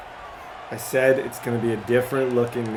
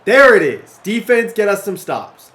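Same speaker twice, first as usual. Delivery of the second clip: loud, raised, punchy celebration; hyped up; 6 semitones higher, 9 dB louder than usual. Real, not acted. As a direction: fire up the team after a big play. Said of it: "I'm hyped".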